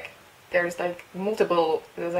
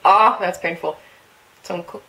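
normal talking voice

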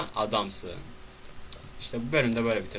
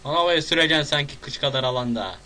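exasperated yell; 5 semitones higher, 8 dB louder than usual. Real, not acted. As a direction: shout at the player to let go. Scolding the game character.

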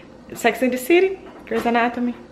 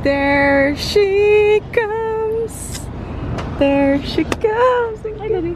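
excited; 10 semitones higher, much slower than usual. Real, not acted. Greeting partner arriving home.

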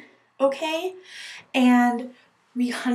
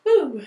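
a light higher "woo" for clearing the hurdle; mock jump woo; 4 semitones higher, 4 dB louder than usual. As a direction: bounce it up like a jump. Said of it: "Instead of going like".

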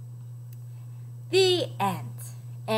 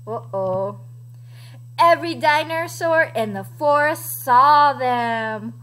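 ordinary delivery

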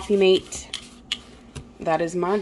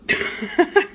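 short laugh; 7 semitones higher than usual. Real, not acted. Laughs at beer on list.

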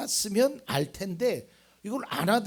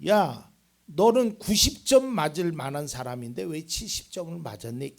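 loud opening that eases into reproach; mock scolding; 9 dB louder than usual. Preacher voices God scolding an underachiever, firm then easing. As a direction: scold firmly, then soften. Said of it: "이렇게는 혼내셔요".

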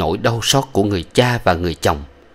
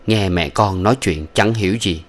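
usual speaking voice

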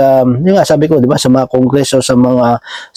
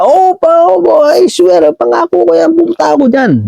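mock boasting. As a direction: send up an empty promise in an exaggerated high voice. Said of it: "minsan yabang lang".

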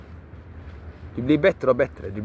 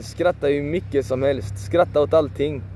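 ordinary delivery

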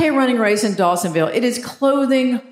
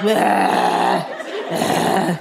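low, drawn-out groan of disgust; mock annoyed; 4 semitones lower than usual. Walgreens clerk asked for a passport picture, mock annoyed. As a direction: imitate a fed-up clerk grumbling wordlessly.

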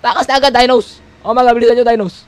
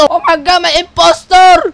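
mock alarm; 6 semitones higher, 5 dB louder, slower than usual. Real, not acted. Spots impostor in game.

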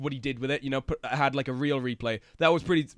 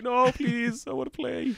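higher pleading voice; pleading little voice; 7 semitones higher, slower than usual. Retold or imitated. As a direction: beg to play. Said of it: "That's like".

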